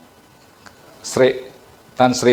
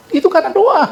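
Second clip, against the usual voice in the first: much higher, louder insistent reply; emphatic insistence; 4 dB louder, faster than usual. Retold or imitated. Preacher insists to a scoffer that it was prayer, raised and emphatic. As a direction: fire it back firmly and high. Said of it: "saya bilang".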